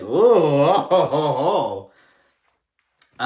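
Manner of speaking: loud, swooping "ooh" breaking into ohs; scandalized oohing. Acted. Host reacts to a reality show confrontation, scandalized oohing. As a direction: hoot like an audience at drama brewing.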